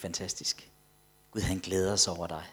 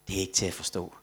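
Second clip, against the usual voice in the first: low, soft, understated quote; retold tearful awe; 4 semitones lower, 5 dB quieter, faster than usual. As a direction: quote the weeping man quietly, without crying. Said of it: "så sad han og græd og sagde". (dæmpet genfortalt bevægelse)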